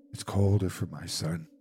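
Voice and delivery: a touch of weeping, kept low; tearful. A narrator's reading. Old woman grieving her son at the window, tearful. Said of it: "and wept afresh".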